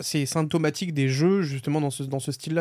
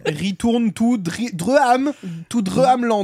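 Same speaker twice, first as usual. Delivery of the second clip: mocking an English accent, drawn out and higher, with a bad English pronunciation of "Dreamland"; 6 semitones higher, 3 dB louder, much slower than usual. Teasing the host's game title with a mock English accent. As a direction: tease the host's English accent on "Return to Dreamland", slowly and louder. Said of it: "De vous moquer de mon accent anglais".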